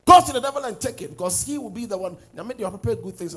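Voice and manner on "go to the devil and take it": loud, forceful demand; shouted command at the devil. Acted. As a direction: order it back with full force.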